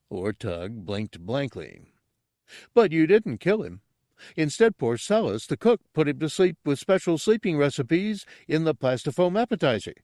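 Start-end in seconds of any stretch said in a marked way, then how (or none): 2.7-9.9 high, excitable character voice; high-pitched simpleton sleuth; dim, eager Gartog. Acted. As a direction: explain your muddled theory eagerly in a high voice. Gartog lays out his theory.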